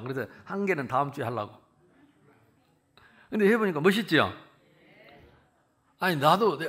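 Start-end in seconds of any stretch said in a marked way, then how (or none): none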